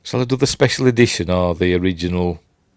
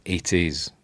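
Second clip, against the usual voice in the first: ordinary talk, nothing marked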